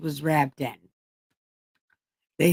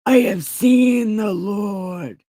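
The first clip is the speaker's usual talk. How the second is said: slow, loud proclamation; announcing; 3 semitones higher, 5 dB louder, slower than usual. Acted. Mary Magdalene announcing to the disciples, slow and loud. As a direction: proclaim it slowly to the room. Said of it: "came announcing to the disciples".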